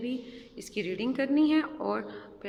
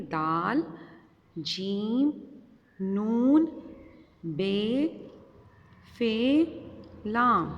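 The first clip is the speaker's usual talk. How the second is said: letter drill; much slower than usual. Shown as a demonstration. Teacher calling letters for children to follow, drill-style. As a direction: spell it out slowly for the class to repeat. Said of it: "हम दोबारा इस line की reading करते हैं".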